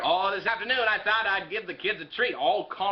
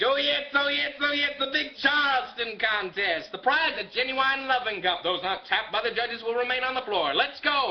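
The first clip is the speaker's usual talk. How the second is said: hammed-up emcee; 8 semitones higher than usual. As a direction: sell the contest like a ringmaster. [name] announcing the Charleston contest.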